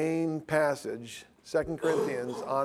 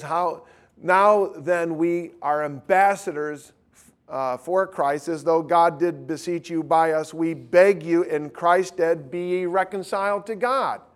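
raised, loud preacher's exhortation; full-force preaching; 6 semitones higher, 7 dB louder than usual. Real, not acted. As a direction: urge the congregation, high and loud.

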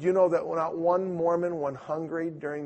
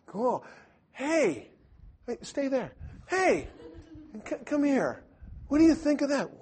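high, eager call to the others; excited calling over; 10 semitones higher than usual. Retold or imitated. Excited Witness calls others over.

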